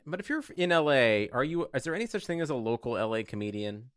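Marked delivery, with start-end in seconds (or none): none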